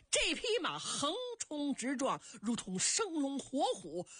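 ordinary delivery, nothing marked

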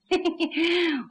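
drawn-out taunting snicker; mocking chuckle. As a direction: snicker in defiance. Commander ordered to back down, villain taunts. (挑衅地嘿嘿笑)

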